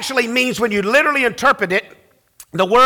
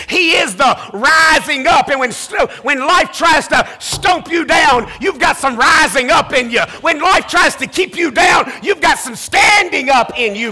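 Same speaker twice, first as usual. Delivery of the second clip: full preaching; 4 semitones higher than usual. Acted. Pastor rousing his congregation about Lazarus, building preaching. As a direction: preach it, climbing toward a shout.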